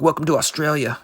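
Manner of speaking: ordinary talk, nothing marked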